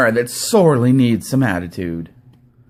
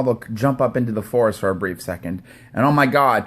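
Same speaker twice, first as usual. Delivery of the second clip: normal talking voice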